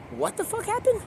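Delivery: very high, soft squeak of disbelief; mock incredulous. Questioning Jim about the fallen monkey, incredulous squeak.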